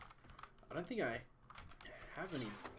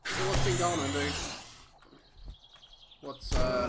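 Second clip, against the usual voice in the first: loud cheery greeting; 2 semitones higher, 9 dB louder than usual. Real, not acted. Calling out to chat viewer.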